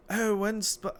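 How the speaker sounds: botched Irish brogue impression; Irish accent. Mocking a friend in a bad Irish accent. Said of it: "I can't do an Irish accent".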